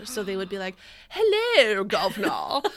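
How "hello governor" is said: fake posh British accent. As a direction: put on a hammy transatlantic posh British voice. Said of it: "people wanted to sound posh".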